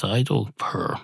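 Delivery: soft, understated cat's refusal; muted denial. Acted. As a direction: state it softly and plainly.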